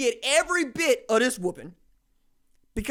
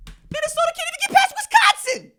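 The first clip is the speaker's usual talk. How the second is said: much higher, louder taunting voice; high mocking jab; 7 dB louder than usual. Mocking Minnesota's losses.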